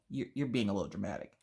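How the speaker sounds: low, soft, quick mimicked husband; hesitant reproach. Husband telling wife she's dramatic, hesitant and soft. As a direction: say it quickly under your voice.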